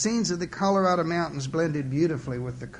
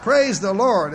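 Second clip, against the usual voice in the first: loud, raised-pitch exclamation; automatic blurt; 5 semitones higher, 8 dB louder, slower than usual. A narrator's reading. Churchgoer on automatic pilot, loud blurt. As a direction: blurt it like a reflex. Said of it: "as though he were on automatic pilot".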